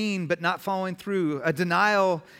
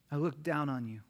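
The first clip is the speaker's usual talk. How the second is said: softly spoken, lower-pitched disdain; quiet contempt; 4 semitones lower, 6 dB quieter than usual. Acted. Preacher voices the contempt we come to feel for others, quiet disdain. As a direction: look down on someone in a low, understated voice. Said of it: "you can only say there's contempt".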